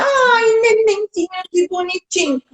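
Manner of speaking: high, sugary cooing; exaggerated sing-song babytalk; syrupy baby talk. Shown as a demonstration. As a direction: demonstrate gushing tati-bitati to a baby. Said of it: "essa voz toda melosinha".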